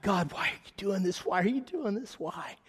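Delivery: high, rising plea that fades out; pleading to God. Retold or imitated. Overwhelmed by the gift, pleading to God high. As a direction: cry out why, voice climbing then dropping.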